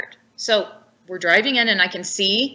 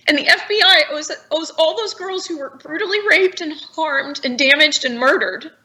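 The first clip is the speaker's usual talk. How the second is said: high, strained voice near crying; tearful accusation; 6 semitones higher than usual. Real, not acted. Demanding the FBI answer to the girls, tearful. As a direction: accuse them while holding back tears.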